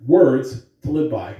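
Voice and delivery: bright, loud school PA announcement; announcer voice. Acted. Principal greeting the school on the morning announcements, loud announcer voice. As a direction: greet the whole school over the intercom.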